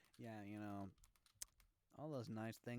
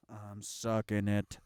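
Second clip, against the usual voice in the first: mock moaning; 12 dB louder, slower than usual. Mock sex noises, joking.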